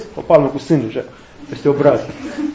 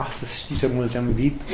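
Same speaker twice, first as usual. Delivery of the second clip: mock soft whisper; 8 semitones lower, 10 dB quieter than usual.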